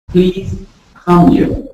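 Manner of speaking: "come" slow with a light K; slow k sound. Shown as a demonstration. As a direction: ease the K into "come". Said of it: "small K effect".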